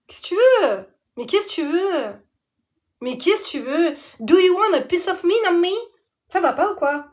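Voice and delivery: indignant outburst. Real, not acted. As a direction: square up to her, half joking. Angry rhetorical outburst at friend.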